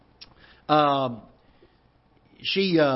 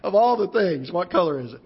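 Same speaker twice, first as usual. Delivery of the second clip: incredulous echo; 4 semitones higher than usual. Retold or imitated. Son echoing his mother's question, incredulous and amused. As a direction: repeat her question in disbelief. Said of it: "And I thought".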